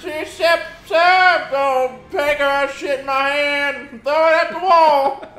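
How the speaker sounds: loud, high, energetic show opener; hyped intro. Acted. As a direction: belt out the intro like a host.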